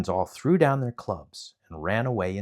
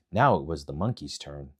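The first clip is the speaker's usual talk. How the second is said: ordinary delivery, nothing marked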